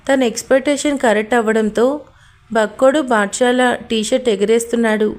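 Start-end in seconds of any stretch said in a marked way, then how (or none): none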